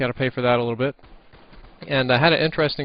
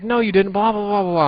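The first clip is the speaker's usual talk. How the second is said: high mocking blah; 10 semitones higher, 5 dB louder than usual. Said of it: "He's like".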